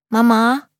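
put-on high piping little boy calling; squeaky child voice. A narrator's reading. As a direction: pipe it up like a small child. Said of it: "ruft Hugo mit piepsiger Stimme".

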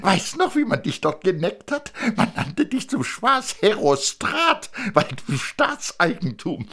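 a proud father showing off his family; thin man presenting wife and son; eager thin man. Acted.

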